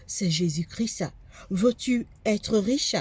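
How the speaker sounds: usual speaking voice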